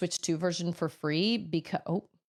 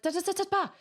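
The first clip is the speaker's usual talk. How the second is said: high, sing-song mock chatter; mock chatter imitating someone talking over you; mocking blah blah; 11 semitones higher, much faster than usual. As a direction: brush off her endless talk in a high mocking voice. Mimics her talking over.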